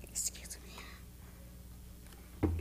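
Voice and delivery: tearful whisper. Real, not acted. Breaks down telling suicide story.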